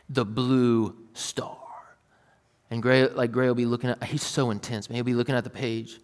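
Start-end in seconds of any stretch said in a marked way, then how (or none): 0.1-1.6 whispered slowly, like a secret game clue; hushed whisper. Retold or imitated. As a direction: lean in and whisper it to a small child. Asking little son to find.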